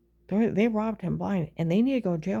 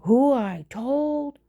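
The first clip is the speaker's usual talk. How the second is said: slow, loud quoted demand in a higher voice; mock scream; 4 semitones higher, 3 dB louder, much slower than usual. Quoting the neighbor screaming at her, loud and slow. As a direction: repeat her shrill demand, drawn out. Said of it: "You were in my apartment screaming".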